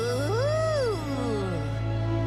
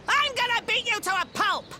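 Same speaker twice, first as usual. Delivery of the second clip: higher, fast, worked-up threat; angry villain threat; 4 semitones higher, 4 dB louder, faster than usual. Acted. Devil insulted by his victim, angry threat. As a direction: burst out with a theatrical threat.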